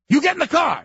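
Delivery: high, loud nagging order; shrill command. Retold or imitated. Nagging wife orders husband into the car, loud high bark.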